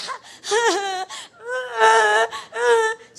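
loud, high mock bawling; hammed-up wailing sobs; comic long wail; imitated crying. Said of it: "Biglang umiyak".

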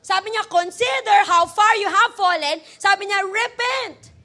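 loud, emphatic sermon call; full-force preaching. Acted. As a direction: drive the key words home to the congregation.

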